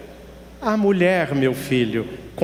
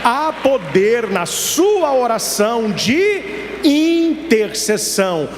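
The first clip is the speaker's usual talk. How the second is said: preaching at full force; 4 semitones higher, slower than usual. Acted.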